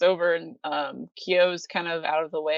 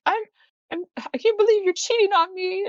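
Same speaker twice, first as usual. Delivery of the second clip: much higher, louder, indignant cat voice; a wronged cat scolding, pitched way up; jealous cat; 13 semitones higher, 5 dB louder than usual. Retold or imitated. The jealous cat scolds Kyo, high indignant voice. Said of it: "translation for her meows".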